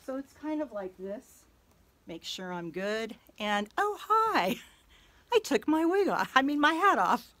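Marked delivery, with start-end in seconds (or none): 3.8-7.3 high, loud startled laugh. Real, not acted. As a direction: caught off guard, laughing. Caught taking wig off.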